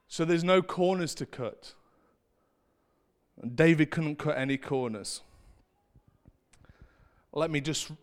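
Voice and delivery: ordinary talk, nothing marked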